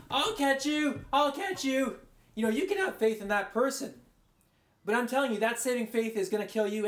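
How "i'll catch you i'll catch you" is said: calling up. Retold or imitated. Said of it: "and like".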